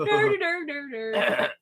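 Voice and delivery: cartoon idiot laugh. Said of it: "I'm trying to do my Beavis laugh".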